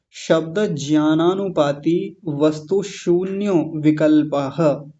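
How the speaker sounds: slow, measured Sanskrit pronunciation; drawn-out, precise teaching recitation; careful sutra recitation. Shown as a demonstration. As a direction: recite the sutra slowly, every syllable clear. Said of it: "इसका शुद्ध उच्चारण समझेंगे".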